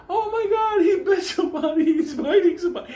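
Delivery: high loud mock shrieks; distant screaming. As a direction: shriek it like panicked strangers. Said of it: "All we heard".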